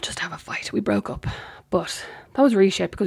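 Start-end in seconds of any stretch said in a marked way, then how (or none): 0.0-1.3 pointed whisper under the breath; hushed whispered correction. Real, not acted. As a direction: let it slip out as a bitter aside. Whispered bitter aside.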